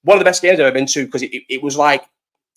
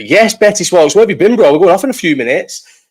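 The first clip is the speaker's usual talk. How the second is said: loud, higher-pitched hail to a latecomer; lively, raised-voice call-out; calling out a greeting; 5 semitones higher, 4 dB louder than usual. Real, not acted. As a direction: shout out excitedly to someone just arriving. Greets someone joining the live show.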